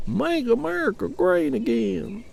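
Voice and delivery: sneering parody of a MAGA fan; mocking Trump supporter. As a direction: ham up the slogan to ridicule it. Mocking a Trump slogan again.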